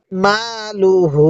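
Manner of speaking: very slow মালুহু, long মা and long হু; drawn-out মালুহু for the learner; model elongated recitation. Shown as a demonstration. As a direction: stretch মা and হু in মালুহু, keep লু short. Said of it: "এরকম ভাবে শিখব".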